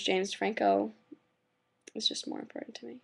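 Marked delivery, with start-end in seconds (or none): 1.9-3.0 whispered, quick and hushed; whispered aside. Pretend. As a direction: slip it in as a secret joke. Joking aside about Terry.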